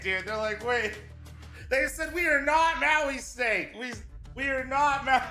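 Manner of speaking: ordinary talk, nothing marked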